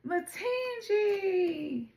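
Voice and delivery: Matangi stretched very slow and high, loud; drawn-out exclamation. Real, not acted. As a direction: savour the name in a long high call. Card revealed, name exclaimed.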